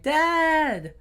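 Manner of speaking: high call for dad. Retold or imitated. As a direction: shout for your father. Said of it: "they're like".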